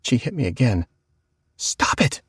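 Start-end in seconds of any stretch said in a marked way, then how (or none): 1.6-2.2 unvoiced urgent retort; whispered snap. Whispered argument with sister.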